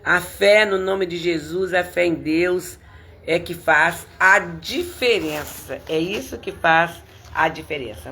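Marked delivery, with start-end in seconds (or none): none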